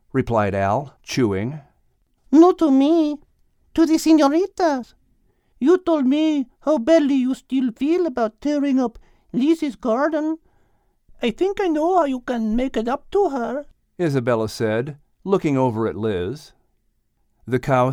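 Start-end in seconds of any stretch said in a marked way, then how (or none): 2.3-13.7 Isabella's voice. A narrator's reading. Narrator voicing Isabella, high and insistent. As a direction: lean in with a high, bright character voice.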